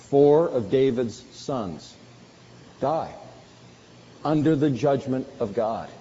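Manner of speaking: ordinary talk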